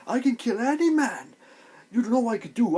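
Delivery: high, loud mock bragging; imitated boast. Fan imitating the mutineers' leader facing Jon, loud high boast. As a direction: crow it like a cocky fighter.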